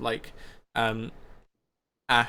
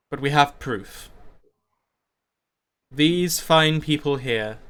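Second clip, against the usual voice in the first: lightly voiced public speech in slow, weighted phrases; solemn town leader. Leader speaking to his community of Glaric's crime, measured and solemn. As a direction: address the crowd with long pauses.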